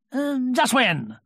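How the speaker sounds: high, slow hesitant stall; a little uncomfortable. A narrator's reading. Tigger dodging Rabbit's question, a little uncomfortable. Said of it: "a little uncomfortably".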